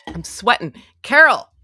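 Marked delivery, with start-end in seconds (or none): 1.0-1.5 loud, high-pitched cry of a name; raised voice, calling someone out; exasperated call. Real, not acted.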